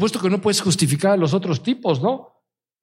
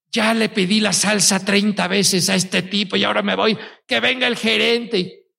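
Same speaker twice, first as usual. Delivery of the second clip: raised, huffy complaint, a touch higher; indignant customer; 3 semitones higher than usual. Retold or imitated. Diner fed up with the waiter, demanding manager, huffy.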